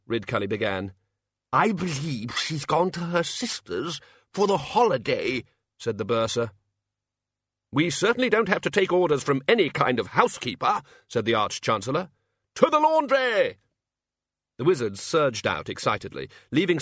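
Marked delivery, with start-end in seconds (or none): 1.5-5.4 slow, high-pitched character voice; bursar character voice. Acted. 7.7-10.8 high, emphatic bluster; indignant Archchancellor. Acted. Archchancellor scorns housekeeper's orders. 12.6-13.6 high, loud, drawn-out battle cry; rallying cry. Acted. Archchancellor rallies the wizards.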